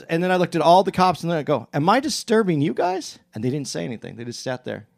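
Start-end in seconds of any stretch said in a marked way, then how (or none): 1.7-3.2 retold pointed question, climbing high; mildly offended. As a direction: appeal to bystanders, a touch affronted. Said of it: "And then I got offended by it".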